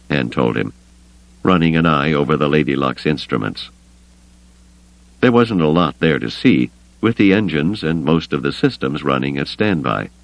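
ordinary delivery, nothing marked

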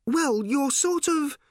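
high, drawn-out, flustered voice; helpless fluster. A narrator's reading. Random trying to describe infinite birds, high and flustered. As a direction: stretch it out high, lost for words.

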